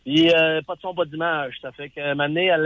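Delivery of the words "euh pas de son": ordinary talk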